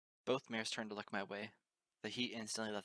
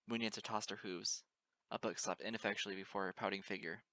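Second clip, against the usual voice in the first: plain reading voice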